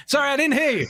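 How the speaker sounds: high-pitched call across a hall. Retold or imitated. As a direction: call back to someone far away. Speaker on stage cannot hear him.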